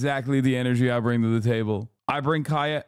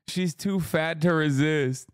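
ordinary talk, nothing marked

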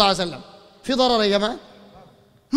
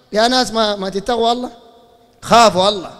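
ordinary talk

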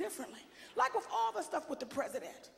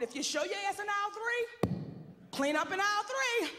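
lightly done supermarket intercom call; a hint of a store clerk over the speaker; mock store announcement; 5 semitones higher than usual. Mother mocking a kid who acts up, like a store announcement.

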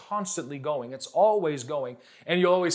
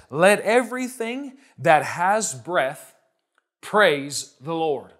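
slow scripture reading; 3 dB louder, slower than usual. Shown as a demonstration. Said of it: "It says".

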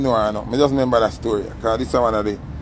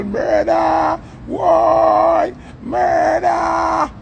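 retold girl's scream, pitched way up; screaming murder; 14 semitones higher, much slower than usual. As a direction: imitate the girl bawling out from the back seat. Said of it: "Me hear the girl a bawl out".

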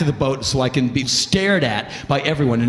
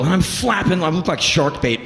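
usual speaking voice

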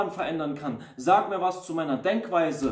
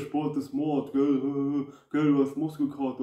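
mocking jab. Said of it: "behandeln dich wie ein Witz".

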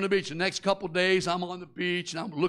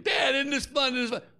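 put-on higher, bright-eyed little girl voice; excited daughter; 8 semitones higher than usual. Pitched-up happy kid. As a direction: daughter thrilled on the ride, mock girlish delight. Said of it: "my daughter's saying".

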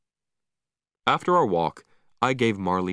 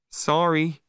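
sheepish apology; 7 semitones higher than usual. A narrator's reading. Owner apologises after the mess.

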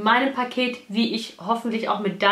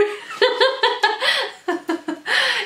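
high giggle; 11 semitones higher than usual. Real, not acted. Overwhelmed laughter at gift.

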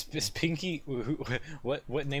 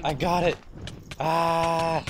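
panicking gamer; 5 semitones higher, slower than usual. Real, not acted. Gamer scrambling in fight.

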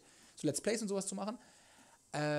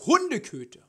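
mock insult voice; 8 semitones higher, 9 dB louder than usual. Reads chat insult aloud.

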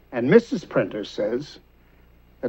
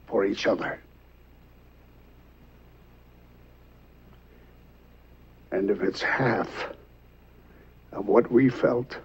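hushed tenderness; 5 semitones lower, 8 dB quieter than usual. Acted. Father on the young couple's love, hushed and tender. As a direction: let it sink to a heartfelt whisper, with long pauses.

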